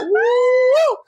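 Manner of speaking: high, joyful whoop; excited whoop. Real, not acted. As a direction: let out a happy woo. Excited whoop after preaching.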